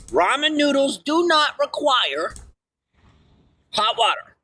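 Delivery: high, slow proclamation with NOT stressed; announcement. Real, not acted. Streamer announcing a ramen fact, proclaiming slowly. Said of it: "alert, tell everybody".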